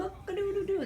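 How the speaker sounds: high sing-song rooster call; rooster crow. Pretend. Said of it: "The rooster says that".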